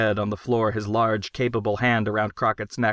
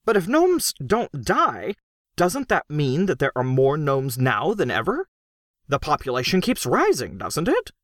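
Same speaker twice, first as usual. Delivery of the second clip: higher, earnest worried questioning; anxious reasoning; 5 semitones higher than usual. A narrator's reading. Crockett puzzles out gnome population to Gru, anxious and higher.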